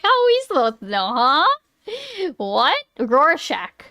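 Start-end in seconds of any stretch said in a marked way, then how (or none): 3.0-3.8 Rorschach as ROAR-SHACK; shack pronunciation. Shown as a demonstration. Streamer trying the supposedly correct version, Rorschach as ROAR-SHACK. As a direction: say Rorschach with a short A, rhyming with shack. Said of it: "that's how you're supposed to say it".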